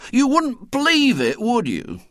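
high, loud exasperated disbelief; helplessly. A narrator's reading. William turns helplessly to his gang, high and exasperated. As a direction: throw up your hands in helpless disbelief. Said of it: "said William helplessly".

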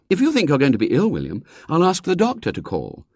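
quick, dry warning from mother; brisk mother. A narrator's reading. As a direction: call the boy's bluff. Mother voiced.

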